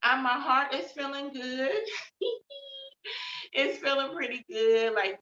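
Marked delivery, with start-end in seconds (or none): 1.8-3.5 light laugh. Real, not acted. Laughs about her heart.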